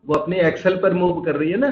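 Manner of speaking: ordinary talk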